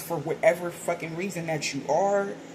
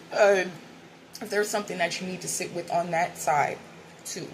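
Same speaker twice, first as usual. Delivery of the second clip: ordinary talk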